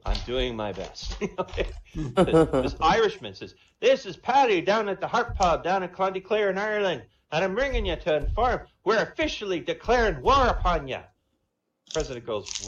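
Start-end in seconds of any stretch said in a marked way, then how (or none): 3.8-11.0 higher, louder Irish brogue; Irish accent. Acted. Paddy the Irishman phoning the president, Irish accent. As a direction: play a cocky Irish pub man. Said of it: "If I sound Jamaican, please forgive me".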